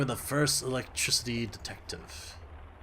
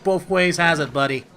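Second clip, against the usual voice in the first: louder, higher defensive sass; defensive retort; 4 semitones higher, 9 dB louder than usual. Real, not acted. Gamer defending sweet coffee to a character, sassy. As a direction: push back on the judgment.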